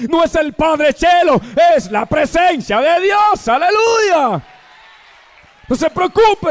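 loud fervent preaching, shouted praise; full-force preaching. Acted. Pastor exhorting the weeping congregation, in loud fervent preaching. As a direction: preach it out, rousing the faithful.

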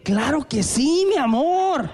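high, slow, sweet yes; eager agreement. Retold or imitated. Husband happily agrees to his wife, sweet eager yes. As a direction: answer sweetly and gladly. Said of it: "¿Cuál es mi respuesta?".

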